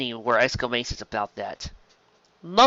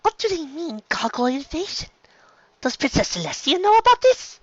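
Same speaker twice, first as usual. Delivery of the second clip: high-pitched, anxious questioning; little sister character. Acted. Little sister wondering about the invasion, high and worried. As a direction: ask high and urgently, rising.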